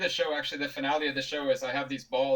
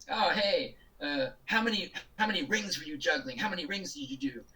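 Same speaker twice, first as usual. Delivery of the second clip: put-on pushy kid; 5 semitones higher than usual. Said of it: "The kid says to Anthony".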